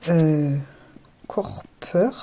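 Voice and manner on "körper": Körper whispered in German; German word. Shown as a demonstration. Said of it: "traduction en allemand". (Körper prononcé à l'allemande)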